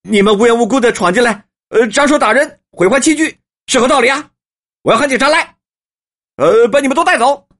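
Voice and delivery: high, loud, indignant protest; emboldened protest. A narrator's reading. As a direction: summon courage and denounce the intruders. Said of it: "只得大着胆子说".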